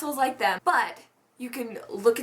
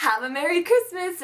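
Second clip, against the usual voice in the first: high, sugary retold send-off; mock-cheery farewell; 7 semitones higher than usual. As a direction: brush them off with put-on cheer.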